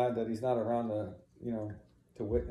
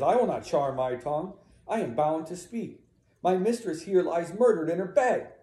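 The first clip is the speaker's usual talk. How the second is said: raised, emphatic, building louder; defiant refusal; 5 semitones higher, 5 dB louder than usual. Acted. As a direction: refuse to be silenced. Emilia refuses to be silenced.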